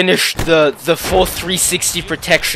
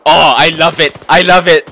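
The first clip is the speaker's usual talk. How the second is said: excited; 8 dB louder than usual. Real, not acted. Delighted at his built tower.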